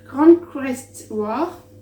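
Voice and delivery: careful, over-deliberate French-accented English on "Grand Crest War"; slow, loud corrected take in a clumsy French-accented English; self-mocked English accent. Real, not acted. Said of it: "Mon accent anglais est toujours aussi génial".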